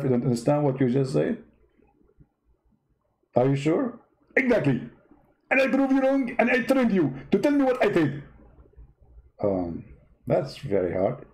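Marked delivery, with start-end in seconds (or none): 4.4-8.2 high-pitched mock debater voice; a squeaky, smug rival preacher; Zakir Naik impression. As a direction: push the voice way up and lampoon him. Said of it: "how you talk like that".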